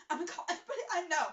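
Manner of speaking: fast, high, loud mock whine; whining. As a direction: whine it out in a dramatic rush. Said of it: "like, whining".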